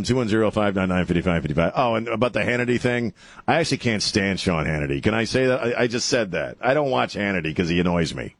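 ordinary delivery

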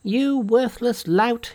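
high-pitched, drawn-out indignant cry; screamed insult. A narrator's reading. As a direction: scream it high and slow, without much extra volume. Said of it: "he screamed".

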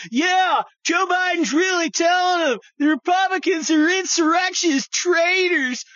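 high, loud mock gushing lib; hammed-up excited liberal shout. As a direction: squeal it like a smug liberal fan. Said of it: "all these libs are like".

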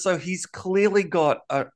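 ordinary talk, nothing marked

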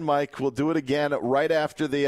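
ordinary talk, nothing marked

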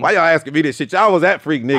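high, loud teasing call-out; playful shout. Real, not acted. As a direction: rib them loudly, laughing. Teasing hosts about Freaknik.

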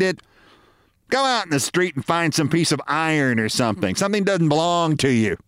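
bossy wife. Acted. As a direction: order him about like a know-it-all. Wife bosses husband.

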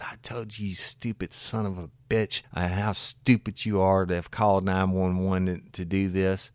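scolding wife. Retold or imitated. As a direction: tear into him.

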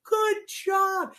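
over-the-top excited praise; big praise. Shown as a demonstration. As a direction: burst with delight for the child. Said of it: "I get him to touch the car and I go".

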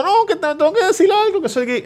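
put-on high, pleading voice of a crying teen; mock teen confession. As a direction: squeak it out like a tearful kid coming out. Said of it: "Ellos llorando".

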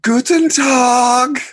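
very high, drawn-out yelled greeting. Retold or imitated. Singer before the breakdown, long high yelled greeting. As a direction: holler it slowly to a whole crowd. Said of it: "[name] before the breakdown goes".

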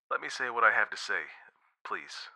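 soft, quick request fading away; subdued plea. A narrator's reading. Boyfriend interrupting girl on the phone, soft plea. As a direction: softly beg to be heard.

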